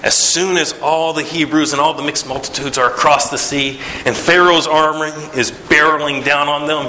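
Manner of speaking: ordinary delivery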